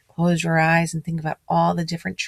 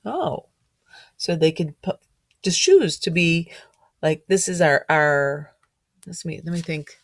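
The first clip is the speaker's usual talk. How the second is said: plain reading voice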